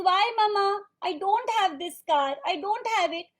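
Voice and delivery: put-on protesting child. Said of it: "He says".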